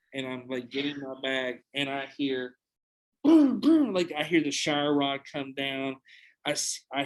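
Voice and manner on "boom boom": loud high boom boom imitation; imitated banging noises; mimicked crashing.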